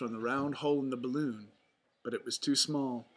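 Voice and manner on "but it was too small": plain reading voice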